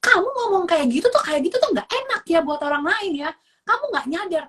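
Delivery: high, loud scolding; acted parent snapping back; angry correction. Shown as a demonstration. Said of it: "mengajarkan anak aku juga untuk marah-marah".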